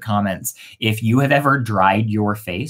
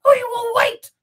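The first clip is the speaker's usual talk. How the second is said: very high bird voice; first bird; 3 dB louder than usual. Acted. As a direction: chirp it high. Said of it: "said the first bird".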